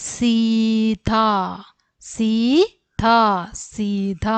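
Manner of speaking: സീത read slowly, syllable by syllable; slow classroom read-aloud. Shown as a demonstration. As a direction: spell out സീത slowly for the children. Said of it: "അക്ഷരങ്ങൾ ഓരോന്നോരോന്നായിട്ട് വായിക്കാം".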